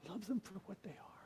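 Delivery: soft whispered echo of the advice; whispered repeat. Retold or imitated. Old priest repeating his advice to Mark, whispered. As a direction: breathe the lesson again, almost silent.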